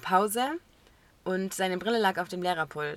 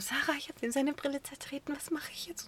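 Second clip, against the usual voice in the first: whispered, higher, panicky; 6 semitones higher, 8 dB quieter than usual. Retold or imitated. Telling her friend Sarah in the corner, frantic whisper.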